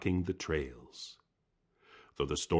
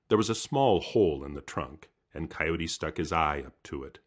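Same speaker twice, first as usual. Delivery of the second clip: plain reading voice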